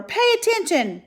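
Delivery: very high, loud command; scream. Acted. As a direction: scream at him to listen. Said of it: "screamed Wilbur".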